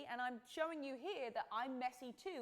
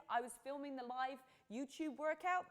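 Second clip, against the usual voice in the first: usual speaking voice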